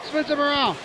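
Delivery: high, rising excited call; sudden burst of ringside excitement; excited play-by-play. Real, not acted.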